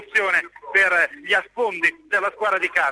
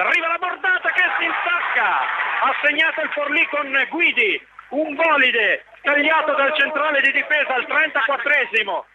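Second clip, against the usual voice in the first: high, urgent goal call; excited goal call; 6 semitones higher than usual. Real, not acted. Gol del Forlì. (annuncio del gol, alto e concitato)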